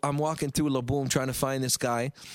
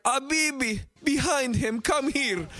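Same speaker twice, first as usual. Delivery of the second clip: higher, lightly voiced Carl waving him over; voicing Carl calling him over; 8 semitones higher, slower than usual. Carl calls his friend over to the section, lightly voiced. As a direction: call him over, pitched up, playful. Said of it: "And Carl's like".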